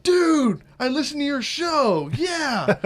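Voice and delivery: high, loud starstruck fan voice; excited fan. Retold or imitated. Host mimics a fan recognizing him, high excited voice. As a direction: gush like an eager fan spotting the host.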